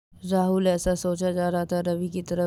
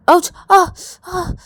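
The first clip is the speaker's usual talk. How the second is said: high, loud cry of pain; pained yelp; 12 semitones higher, 12 dB louder than usual. Acted. Ravi hurts in Rahul's hug, pained yelp. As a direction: yelp sharply, then gasp.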